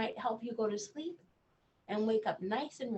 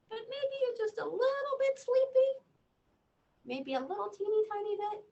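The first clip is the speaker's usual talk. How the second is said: much higher, sing-song teasing voice; playful teasing; 12 semitones higher than usual. Acted. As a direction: coax the child playfully, way up high. Teasing child about sleepiness.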